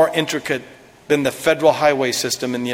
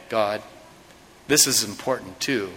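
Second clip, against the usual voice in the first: ordinary talk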